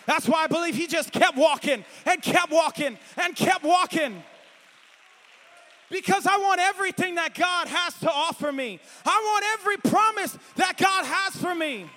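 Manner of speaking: fiery shouted preaching; full-force preaching. Acted. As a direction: drive it home to the church.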